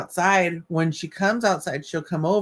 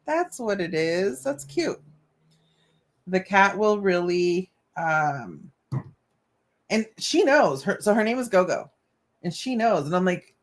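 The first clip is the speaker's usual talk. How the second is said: ordinary talk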